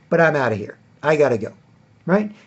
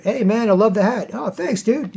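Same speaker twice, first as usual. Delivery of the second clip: friendly guys; 7 semitones higher than usual. Retold or imitated. Friendly party exchange voiced.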